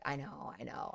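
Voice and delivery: low, soft imitated player; retold meek concession. Shortstop concedes to coach after scolding, low meek mimicry. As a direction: give in quietly, as the scolded player.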